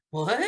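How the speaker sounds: "what?" rising as a puzzled question; playful, quizzical lift on "what?". Shown as a demonstration. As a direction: act out the question mark on "what?". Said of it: "a question mark is the".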